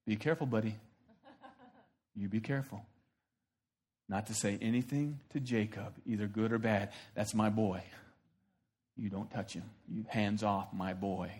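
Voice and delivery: low, soft, firm warning from God; quiet menace, protective father; God warning Laban off his boy; stern God. Acted.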